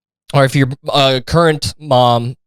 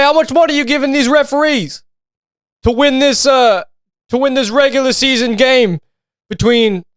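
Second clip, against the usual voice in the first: high taunting mock outrage; mock accusation; 10 semitones higher than usual. Heckling the team over paying refs, mock accusing. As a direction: taunt them about bribing refs.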